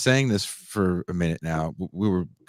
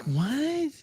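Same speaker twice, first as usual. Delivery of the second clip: surprised; 5 dB quieter than usual. Retold or imitated. Baffled reply in retold story.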